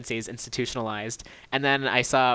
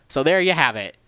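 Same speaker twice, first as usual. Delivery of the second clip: normal talking voice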